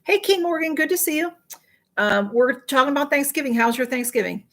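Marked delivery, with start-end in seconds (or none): none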